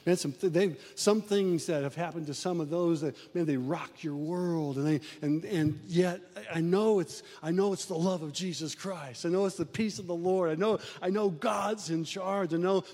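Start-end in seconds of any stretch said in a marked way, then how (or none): none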